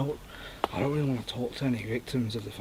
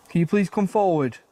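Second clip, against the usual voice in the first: raised, higher voice addressing the unseen; calling out; 6 semitones higher, 6 dB louder than usual. Real, not acted. Investigator asks the ghost to come forward, calling out. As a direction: project it as a clear request.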